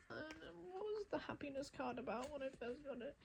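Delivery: usual speaking voice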